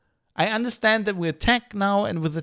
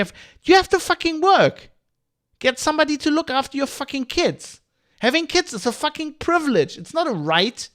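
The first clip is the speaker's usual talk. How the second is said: real rant; 9 semitones higher than usual. Real, not acted. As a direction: blast it at the parents, furious. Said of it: "I'm just ranting now".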